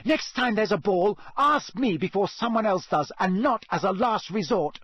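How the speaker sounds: put-on high, heated girl's outburst; angry bellowing. A narrator's reading. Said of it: "they were bellowing at each other".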